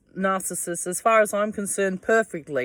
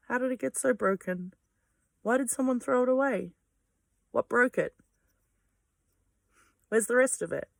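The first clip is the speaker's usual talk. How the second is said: higher, lightly voiced mock wonder over a broken find; childlike wonder; 7 semitones higher than usual. Picking up a fallen broken thing, mock wonder. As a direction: marvel at it like a curious child. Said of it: "And I go".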